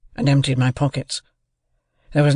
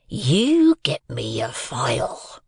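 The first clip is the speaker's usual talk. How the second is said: slow, emphatic, drawn-out order; menacing convict; much slower than usual. A narrator's reading. Convict tilts the boy and gives orders, menacing. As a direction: draw out each order as a threat.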